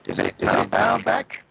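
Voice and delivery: distorted, choppy connection voice; glitching audio. Real, not acted. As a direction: voice breaking up online. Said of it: "Darth Vader having a cold".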